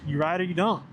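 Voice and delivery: ordinary talk, nothing marked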